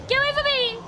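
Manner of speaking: high, alarmed cry; panicked yelling. Real, not acted. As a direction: shout it to drive off an attacker. Fleeing attacker in game.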